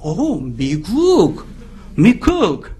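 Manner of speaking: slow, drawn-out awe at America; impressed "oh, America". Retold or imitated. Pastor mimics locals suddenly dazzled by an American. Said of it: "태도가 변해요".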